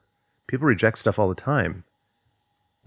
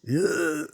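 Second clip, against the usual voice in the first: disgusted ugh; 9 semitones higher than usual. Retold or imitated. Reacting to feeling undesirable.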